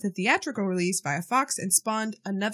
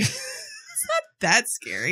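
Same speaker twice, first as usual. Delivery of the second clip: laughing; slower than usual. Real, not acted. Laughing protest about West Virginia.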